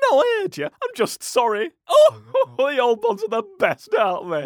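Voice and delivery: high cackling crone; old woman voice. Acted. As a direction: cackle at your own joke.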